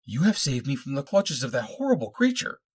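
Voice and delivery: high-pitched, overwrought gratitude; hysterical relief. A narrator's reading. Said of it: "she cried hysterically".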